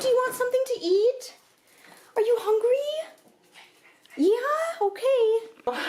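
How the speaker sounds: very high, coaxing questions to a dog; pet talk. Real, not acted. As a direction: coo high, lifting each question at the end. Asking her dog if hungry.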